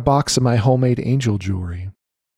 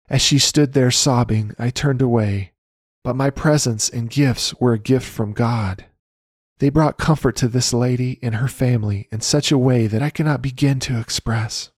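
ordinary talk

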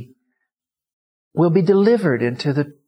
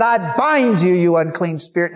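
loud, high binding command; commanding rebuke; 6 semitones higher, 6 dB louder than usual. Retold or imitated. Preacher binding the unclean spirit, loud and forceful. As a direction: bind the spirit with full authority.

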